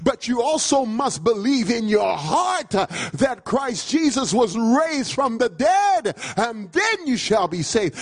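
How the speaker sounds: driving, emphatic sermon delivery; full-force preaching. Real, not acted. Preacher telling the church what the Bible says, full-force preaching. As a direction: hammer each phrase home to the congregation.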